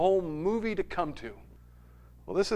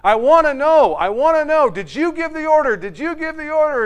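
higher, louder insistent grilling; mock badgering demand; 7 semitones higher, 5 dB louder than usual. Lawyer badgering the colonel on the stand, insistent. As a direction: press the witness over and over. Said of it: "Tom Cruise is there badgering him".

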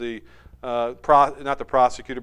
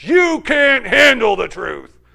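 slow, louder menacing retort; Jack Nicholson impression; 3 semitones higher, 7 dB louder, slower than usual. Colonel snapping back at the lawyer, slow menace. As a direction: lean in and sneer it slowly. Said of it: "Jack Nicholson looks at him and says".

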